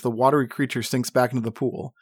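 ordinary delivery

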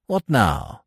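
mildly startled question; understated exclamation of surprise; cried out. A narrator's reading. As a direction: call it out to those behind. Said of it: "cried Smith".

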